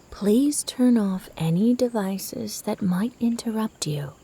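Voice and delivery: normal talking voice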